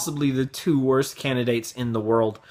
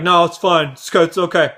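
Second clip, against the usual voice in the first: mock dismissive; 5 semitones higher, 7 dB louder than usual. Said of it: "you're like".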